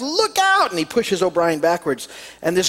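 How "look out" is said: shout. Acted. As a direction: yell it out to save your friend. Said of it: "Murphy shouts".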